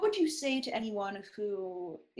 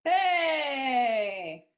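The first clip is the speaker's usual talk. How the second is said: shout; 5 semitones higher, 5 dB louder than usual. Real, not acted. Pencil mentioned, calls out.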